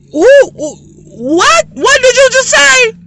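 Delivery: high, loud disbelief; loud, high shocked what did you just say. Pretend. Shocked reaction to child.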